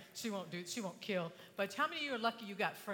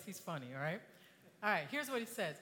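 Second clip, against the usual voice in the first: ordinary delivery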